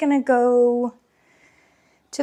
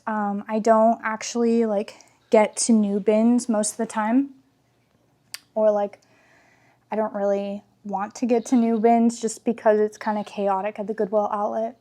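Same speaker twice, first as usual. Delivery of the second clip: usual speaking voice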